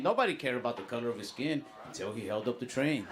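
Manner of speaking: ordinary talk, nothing marked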